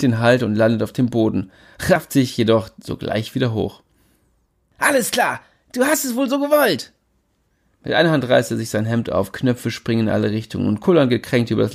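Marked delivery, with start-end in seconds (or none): 4.8-6.9 high cocky fight challenge. Acted. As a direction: square up, boastful. Jimmy ready to fight.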